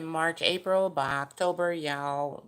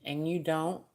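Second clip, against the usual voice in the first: normal talking voice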